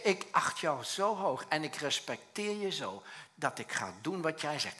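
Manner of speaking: normal talking voice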